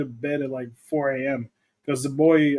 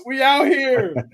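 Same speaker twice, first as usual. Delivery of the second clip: hype boast; 9 semitones higher, 5 dB louder, much slower than usual. Acted. Hyping Olympic gold medal.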